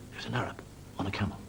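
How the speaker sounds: ordinary talk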